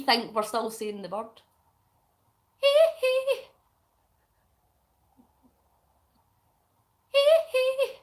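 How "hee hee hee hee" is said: high squeal. Pretend. [name] style squeals.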